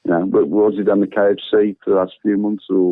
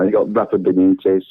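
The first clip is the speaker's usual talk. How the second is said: ordinary delivery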